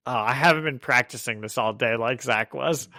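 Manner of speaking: ordinary talk, nothing marked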